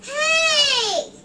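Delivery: loud, high indignant hey; protesting shout. Real, not acted. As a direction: cry out in protest. Child protests nose game.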